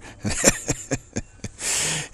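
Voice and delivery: long helpless giggle; wheezy run of laughter; amused laughter. Real, not acted. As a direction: laugh freely at the absurdity. Finds the idea hilarious.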